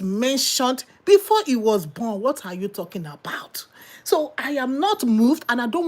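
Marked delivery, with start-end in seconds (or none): 2.2-3.7 unvoiced incredulous whisper; whispered aside. Preacher whispering a mock aside to the congregation. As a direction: whisper it in disbelief.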